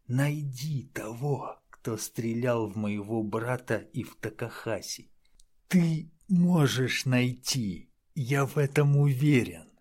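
lightly voiced urgent old man; frail old mentor. A narrator's reading. Simura voiced as figure.

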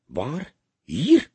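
jumpy, sharply rising questions; a bit anxious. A narrator's reading. Burglar learning of a murder in this building, anxious. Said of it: "klonk het een beetje angstig".

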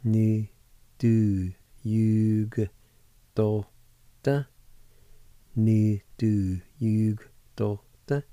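Niðurjúkdóttir drawn out very slowly, syllable by syllable; slow pronunciation. Shown as a demonstration. Teacher to students learning Cherokee, very slow and careful. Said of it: "you can pronounce the G with the Y U like this".

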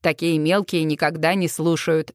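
low, offhand male voice; fairground lad. A narrator's reading. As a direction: drop low for a seasoned lad's remark. The lad's voice in narrated dialogue.

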